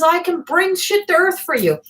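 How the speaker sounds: hammed-up grandpa showing off; grumpy grandpa boasting. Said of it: "he says".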